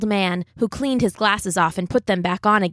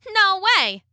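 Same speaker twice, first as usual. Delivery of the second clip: high cheeky refusal; cocky little cookie voice; gingerbread man; 11 semitones higher than usual. A narrator's reading. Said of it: "said the gingerbread man".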